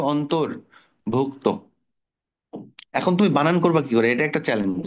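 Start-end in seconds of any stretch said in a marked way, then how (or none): 0.0-1.4 অন্তর্ভুক্ত drawn out slowly, every sound clear; slow model word. Shown as a demonstration. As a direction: lay out অন্তর্ভুক্ত slowly, ontor-bhukto. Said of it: "উচ্চারণটা দেখো". (ধীরে স্পষ্ট উচ্চারণ)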